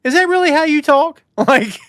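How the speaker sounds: high, loud disbelieving squeak; incredulous. Retold or imitated. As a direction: ask it in total disbelief. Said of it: "And you're like".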